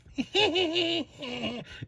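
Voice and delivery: high put-on goofy laugh; silly character laugh; weird Weasley laugh. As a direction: cackle like a weird cartoon weasel. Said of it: "a weird Weasley laugh".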